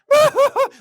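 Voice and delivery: very high, loud pulsing wail; loud high hooting cry. Acted. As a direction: imitate an overcome whoop in short bursts. Imitated noise of emotional release.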